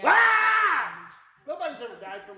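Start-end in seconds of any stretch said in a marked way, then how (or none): 0.1-1.1 a sudden loud, high "Ah!"; a loud, high shout; loud demo shout. Shown as a demonstration. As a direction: blast out the sound to show it is harmless. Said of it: "A sound".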